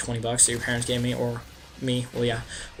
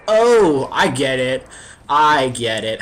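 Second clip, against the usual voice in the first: sudden realization; 10 dB louder, slower than usual. Retold or imitated. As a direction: catch on all at once, loudly. Realizing she wants a kiss.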